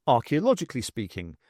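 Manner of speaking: plain reading voice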